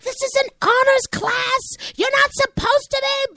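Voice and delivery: high, slow, full-force scolding; furious high-pitched telling-off; outraged scream. Retold or imitated. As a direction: scream at the class like an outraged administrator. Assistant principal storms in on noisy class.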